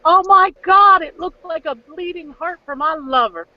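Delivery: swooning Rosita. Retold or imitated. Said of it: "She thought".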